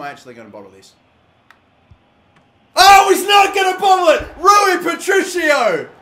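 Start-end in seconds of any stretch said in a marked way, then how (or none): 2.8-5.9 screaming high and loud, name stretched out slowly; ecstatic shout. Real, not acted. Gamer hailing the keeper's save, ecstatic scream. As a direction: roar the keeper's name in triumph. Keeper saves, shootout drama.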